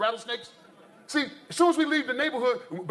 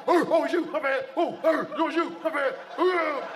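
high barks breaking into apology; acted jumpy dog, pitched up; startled dog; 5 semitones higher than usual. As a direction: bark in alarm, then sheepishly recognize the owner. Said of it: "I come home".

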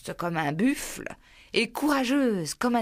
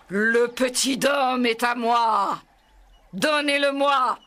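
loud, higher furious tiger roar; roaring shout; 4 semitones higher, 5 dB louder than usual. A narrator's reading. Shere Khan roars at the wolf council, loud furious shout. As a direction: bellow the demand at the pack. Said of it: "Shere Khan surgit de la forêt et rugit".

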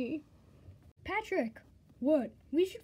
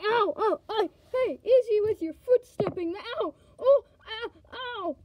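loud ow ow cries; pained yelps; 4 semitones higher, 6 dB louder than usual. Acted. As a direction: yelp in pain.

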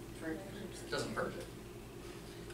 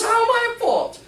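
high, loud indignant question; indignant head nurse; 8 dB louder than usual. Retold or imitated. Head nurse defending herself, high and indignant. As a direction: push back, offended. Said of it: "She says".

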